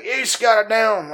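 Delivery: put-on high, loud, drawn-out good old boy holler; mock angry yell. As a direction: holler it like an irate Louisiana welder inspector. Retold QA guy yelling at him.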